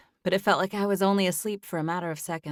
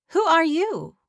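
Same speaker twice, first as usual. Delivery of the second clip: surprised woman; 11 semitones higher, 8 dB louder, slower than usual. A narrator's reading. Woman questions startled stranger.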